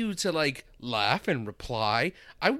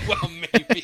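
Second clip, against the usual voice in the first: mocking echo; 12 semitones higher, 7 dB louder than usual. Mocking his partner's hedge.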